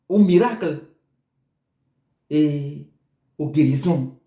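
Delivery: ordinary delivery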